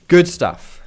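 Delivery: bright, loud, high "Good stuff"; excited cheer. Real, not acted. Streamer hyping a teammate's play, loud excited cheer.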